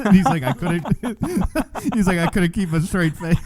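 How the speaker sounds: high-pitched, laughing through the words; helpless giggles while telling a joke; cracking up. Real, not acted. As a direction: barely get the punchline out through laughter. Cracks up telling meme punchline.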